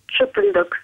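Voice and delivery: Чипульдук with a hard Belarusian ч, high pitch; Belarusian accent. Shown as a demonstration. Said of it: "ну-ка произнесите".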